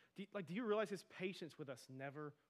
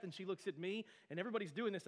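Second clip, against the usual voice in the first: ordinary delivery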